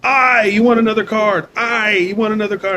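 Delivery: mock the guy saying I; imitating the dealer. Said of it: "this fucking guy pronounced it I".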